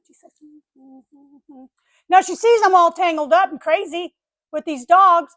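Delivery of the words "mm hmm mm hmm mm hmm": very soft, tight little hums; hushed mock-prim neighbor; prim and proper lady. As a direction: hum with demure superiority. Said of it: "all prim and proper".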